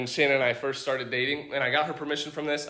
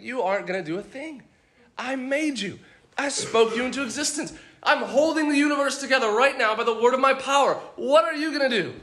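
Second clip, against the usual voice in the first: higher-pitched, incredulous divine rebuke; acting out God dismissing puny challengers, pitched up; mildly scoffing challenge; 8 semitones higher than usual. God voiced scoffing at rebellious kings.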